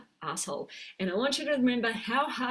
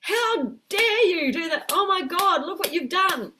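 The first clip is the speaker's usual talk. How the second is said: angry scolding; 9 semitones higher, 6 dB louder than usual. Acted. Adult self scolding the inner child, angry loud rebuke. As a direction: tell the child off, indignant and loud. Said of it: "our adult self is getting mad".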